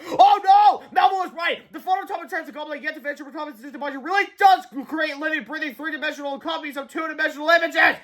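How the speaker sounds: high, panicked shout rattling on; shrieked start, breathless high ramble; convoluted cry. A narrator's reading. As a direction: wail out a long-winded alarm. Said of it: "Harold cried convolu-, convolutedly".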